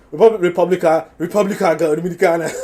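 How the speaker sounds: hammed-up Spanish-accented pronunciation; mock Spanish accent. Host poking fun at a guest's pronunciation, mock Spanish accent. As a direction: roll it out in a joking Spanish accent. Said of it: "y'all catch that Spanish accent?".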